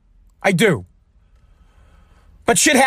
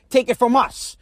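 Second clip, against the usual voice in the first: normal talking voice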